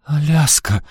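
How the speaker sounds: high, dismayed cry; pained, high-pitched reproach; upset. A narrator's reading. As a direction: gasp out the name in distress. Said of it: "расстроилась мать".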